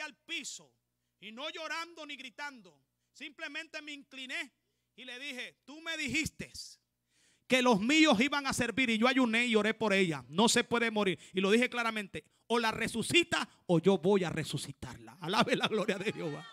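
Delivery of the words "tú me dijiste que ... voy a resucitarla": loud, high preacher's shout; preaching at full force. Real, not acted. A preacher shouting to the congregation in full fervor. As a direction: preach it out at full volume, climbing.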